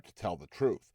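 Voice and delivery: ordinary delivery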